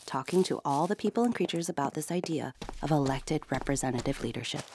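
plain reading voice

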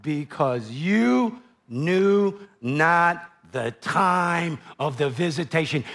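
"Because you knew not the time" drawn out very slowly; slow, weighty reading. Shown as a demonstration. Preacher to congregation, slow weighty phrase. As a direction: linger on every word of the phrase. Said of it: "Listen to this last phrase".

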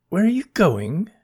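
high eager asking voice; curious question. Acted. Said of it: "asked Bernie".

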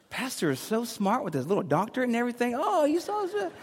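high-pitched gushing fan voice; gushing admirer. Acted. Mimics gushing fans in the congregation.

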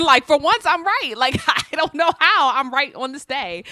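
laughing loud and high, triumphant; giggly gloating, voice up high. Real, not acted. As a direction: crow with laughter at finally being right. Laughing at being right.

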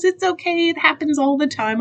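high-pitched imitation of a forgiving clerk; reassuring store employee. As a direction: lift the voice to excuse someone's mistake.

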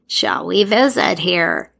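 musing character voice; Pesta voice. A narrator's reading. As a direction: wonder aloud to nobody. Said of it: "Pesta said to no one in particular".

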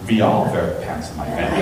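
a hint of a German exchange student; lightly voiced German student. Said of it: "exchange student from Germany".

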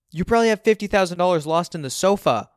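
high, loud accusation; angry. A narrator's reading. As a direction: throw it at dad angrily. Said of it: "I'm too angry to consider".

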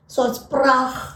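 prach with a harsh throaty G at the end, said slowly; guttural G. Shown as a demonstration. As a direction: stretch the G in prach into a rasping ch sound. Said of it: "they put a lot of emphasis in G's".

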